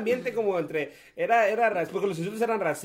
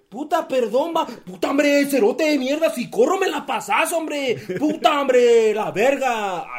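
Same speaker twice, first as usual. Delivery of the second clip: higher, louder angry rant, dragging the last words slowly; mock angry player; 5 semitones higher, 6 dB louder, slower than usual. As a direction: bark at a teammate who won't pass. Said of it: "yo así de". (imitando a un compañero enojado en la cancha)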